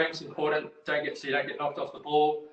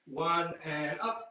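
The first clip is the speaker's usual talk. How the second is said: drawn-out coach call; much slower than usual. Real, not acted. Coach counting down a plank.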